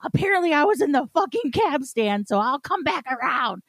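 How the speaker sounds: high-pitched, worked-up text read aloud; ragey. Retold or imitated. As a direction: act out an irate text to a friend. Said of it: "So I'm texting Davion all ragey".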